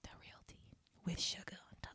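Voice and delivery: whispered, very soft echo; hushed mock-secret aside; playful whisper.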